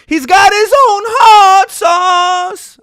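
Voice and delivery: high slow shouted song; mock belting. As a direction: belt it like an anthem. Shouting sung tribute.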